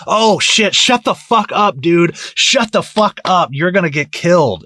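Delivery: high, loud panicked hush-up; urgent mock warning, high and loud; alarmed warning. Viewer urging a mouthy character to shut up, loud and panicked.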